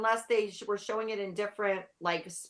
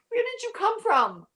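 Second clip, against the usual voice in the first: surprised; 4 dB louder than usual. Retold or imitated. Reacting to surprise appearance.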